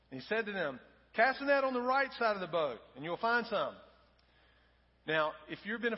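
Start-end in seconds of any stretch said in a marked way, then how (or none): 1.1-3.7 loud, higher hail across the water; Jesus shouting directions from shore to the fishermen; calling out. Acted. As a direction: call the instruction out to the boat. Jesus calls instruction to boat.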